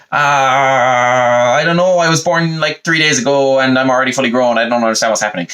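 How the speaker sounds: mock helpless whine, easing down toward the end; flustered excuse. Eve pleading ignorance to God, flustered and high.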